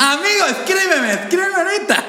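high-pitched, pleading eagerness; over-eager. Retold or imitated. Actress accepting his offer to record, comically over-eager. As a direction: beg as if the chance might vanish.

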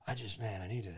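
imitating him, soft and mopey; mopey quiet mumble. Friend mimics Kyle's low mopey mumble outside the hotel. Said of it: "And he's like".

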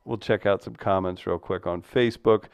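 usual speaking voice

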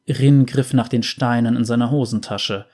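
plain reading voice

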